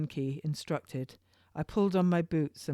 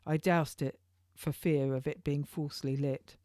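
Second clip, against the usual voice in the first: plain reading voice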